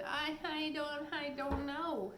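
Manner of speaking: stammering. Acted. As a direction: stammer it out frightened. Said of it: "stammered the poor pixie".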